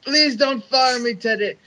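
high, loud begging; imitated wail of a man begging; mock screaming plea. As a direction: plead desperately for your job, hammed-up. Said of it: "just starts screaming at Teddy Long".

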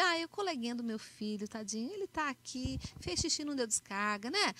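a mother mildly mocking her son's friend; condescending pity. Shown as a demonstration. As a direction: talk down about the little boy. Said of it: "Eu não vou falar assim com o filho".

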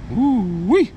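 high, rising squeal of delight; delighted ooh-wee. Real, not acted. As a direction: whoop it up high with glee. Admiring what he just bought.

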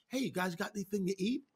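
quick, offhand and soft, like a hungry buddy; casual guy Jesus. Retold or imitated. Jesus back from the tomb asking the disciples for food, quick and offhand. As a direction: toss it off casually to the guys. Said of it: "total guy thing".

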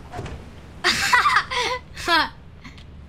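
high burst of laughter; gleeful laugh. Real, not acted. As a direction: laugh at your own prank. Laughs after prank.

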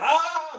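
high, drawn-out "aah" imitating chaos; mock commotion wail. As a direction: imitate a room in uproar. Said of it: "Everything is going".

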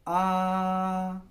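put-on a long flat high आ like a child opening its mouth for food; first tone. Shown as a demonstration. Teacher models first tone आ, held level and high. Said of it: "बिल्कुल rise नहीं हो रही".

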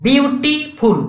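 ବିୟୁଟିଫୁଲ୍ slowly split, "byu - tii - phul"; syllable-by-syllable demo. Shown as a demonstration. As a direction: count out three syllables in ବିୟୁଟିଫୁଲ୍. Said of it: "ଦୁଇଟାରୁ ଅଧିକା ହଉଛି".

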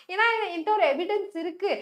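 ordinary delivery

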